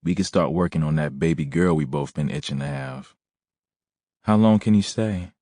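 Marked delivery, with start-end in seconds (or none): 4.2-5.3 lightly voiced woman. A narrator's reading. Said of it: "she asked".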